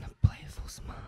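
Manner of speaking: breathy playful whisper. Acted. Comic poet whispering to the crowd. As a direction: whisper it with a sly smile.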